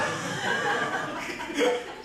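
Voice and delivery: a burst of high, breathless laughter. Real, not acted. Preacher laughing at his own joke about Jesus. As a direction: crack up at the punchline.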